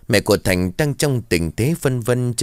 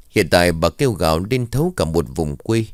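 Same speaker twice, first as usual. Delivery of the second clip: ordinary delivery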